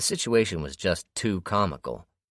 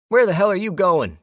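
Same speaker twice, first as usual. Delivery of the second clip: loud, high demand hurled after him; angry yell; 12 dB louder than usual. A narrator's reading. Tank shouts after the captor walking away, angry yell. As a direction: shout it at his back in outrage.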